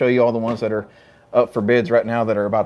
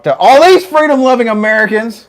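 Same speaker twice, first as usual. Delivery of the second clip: loud greeting; 7 semitones higher, 7 dB louder than usual. Real, not acted. Greeting the crowd of visitors.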